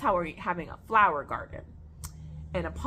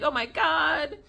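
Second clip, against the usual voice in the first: higher-pitched mock worry; retold worry; 7 semitones higher than usual. Owner frets over her snake's belly, retold worry. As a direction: replay your own fretting, raised pitch. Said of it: "worried and I'm like".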